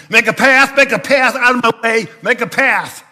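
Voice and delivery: high, loud urgent calling out; calling out to clear a path. Retold or imitated. Husband shouting to shoppers while pulling his sick wife.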